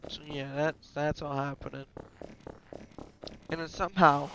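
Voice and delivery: ordinary talk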